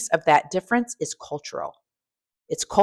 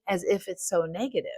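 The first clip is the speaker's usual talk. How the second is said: ordinary talk, nothing marked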